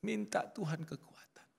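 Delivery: slowed whisper of a prayer; hushed prayer drawn out slowly; whispered plea. Real, not acted. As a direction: whisper it, slow and breathy, toward God. Preacher drops to a whispered plea.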